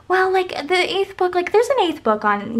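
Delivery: placating mom, lightly imitated up high; imitating mom. Mom siding with the baby brother, high imitated voice. Mom's quote, defending the baby.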